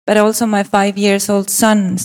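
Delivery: normal talking voice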